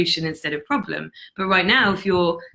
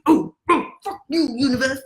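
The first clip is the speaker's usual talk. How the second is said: mock whiny grumble; 5 semitones higher than usual. Mocking someone who blames the universe.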